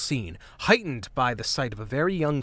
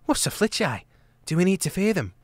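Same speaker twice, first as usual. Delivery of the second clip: put-on higher, anxious young girl voice; anxious young hedgehog; 7 semitones higher than usual. Trimp asking Martin and Gonf, anxious young voice. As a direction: ask nervously, wide-eyed.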